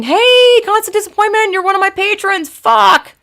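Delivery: high, loud excited hello; light up spotting a patron in chat; excited greeting. Real, not acted. Streamer spotting a patron in chat, excited greeting.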